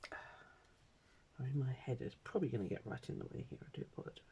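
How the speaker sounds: soft muttered aside, whispered. Real, not acted. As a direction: mutter it under your breath.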